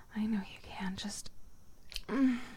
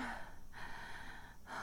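long sigh; 15 dB quieter than usual. Acted. Contented sigh during roleplay.

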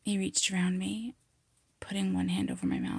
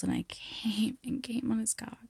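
normal talking voice

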